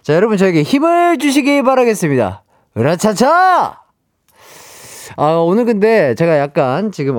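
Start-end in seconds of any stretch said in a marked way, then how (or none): 2.8-3.8 slow psych-up heave; effort grunt. Real, not acted. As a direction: heave it out before lifting. Psyching up before the game. (기합 넣는 으라차차)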